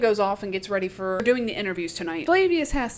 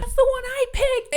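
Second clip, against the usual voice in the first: very high, hammed-up fan squeal; mock squealing; 3 dB louder than usual. Capitol crowd seeing the wedding dress they picked, mock squealing.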